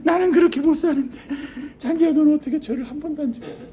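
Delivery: high, loud anguished cry; wailing plea. Retold or imitated. 잔디에게 울부짖는 남자, 높고 큰 통곡. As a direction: wail it out to the grass. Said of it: "잔디가 부러워서 잔디를 막 부여잡고".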